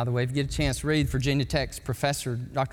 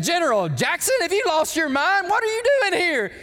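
much higher, louder and rising in disbelief; astonished General Lee; 13 semitones higher, 4 dB louder than usual. Retold or imitated. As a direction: blurt out the question in shocked amazement. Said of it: "he said".

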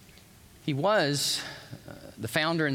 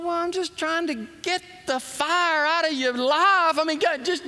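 exasperated; 12 semitones higher, 4 dB louder than usual. Retold or imitated. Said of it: "I thought".

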